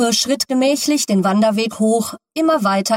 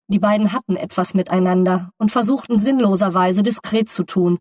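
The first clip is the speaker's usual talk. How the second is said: usual speaking voice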